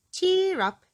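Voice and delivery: high, loud bright cheer; jolly her along; hearty encouragement. Acted. Doctor Make-You-Well to glum Miss Trouble, a hearty high cheer.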